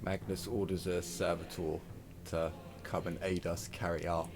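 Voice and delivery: usual speaking voice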